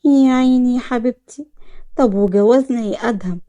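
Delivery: plain reading voice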